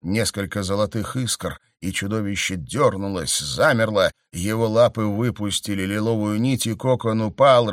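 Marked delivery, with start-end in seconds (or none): none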